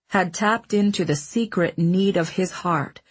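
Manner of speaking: plain reading voice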